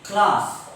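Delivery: CLASS with long open "ah"; British class. Shown as a demonstration. Teacher repeating for students, CLASS with broad British "ah". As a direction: say CLASS as "klaas". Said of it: "In British English".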